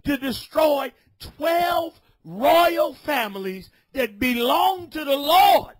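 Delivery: shouted, high street sermon; fiery preaching. Acted. Street preacher scolding a brother, shouted preaching. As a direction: preach it full force at the crowd.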